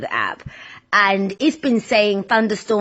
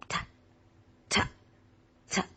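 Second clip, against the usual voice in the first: imitating raindrops; 2 semitones higher than usual. Host showing how the rain began, raindrop imitation speeding up. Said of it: "that's how the rain came".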